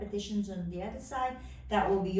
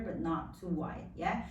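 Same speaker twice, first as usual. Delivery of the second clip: ordinary talk, nothing marked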